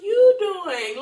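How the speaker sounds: high, loud sing-song politeness; fake-sweet deflecting. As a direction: chirp it cheerfully to shut it down. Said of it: "And I'm like".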